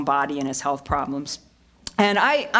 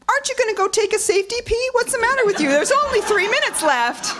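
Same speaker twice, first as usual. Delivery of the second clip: much higher, fast teasing nag; high mock-scolding Mike. Producer nagging her to take a safety pee, high fast scold. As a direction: squeak it out like a fussy boss. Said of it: "he'll go".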